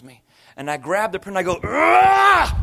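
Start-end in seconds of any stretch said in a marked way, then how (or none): 1.6-2.5 loud, high growling yell of exasperation; frustrated roar. Retold or imitated. Overwhelmed man grabs the printer to throw it, frustrated roar. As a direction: let out an overwhelmed, exploding roar.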